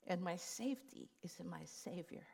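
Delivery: unvoiced hushed whisper. Realizing her safety is in her Savior, whispered. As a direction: breathe it out as a secret relief.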